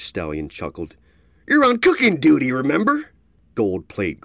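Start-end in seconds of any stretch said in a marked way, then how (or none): 1.5-3.1 high, loud taunting thug; brutish bully. A narrator's reading. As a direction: shove him around with words.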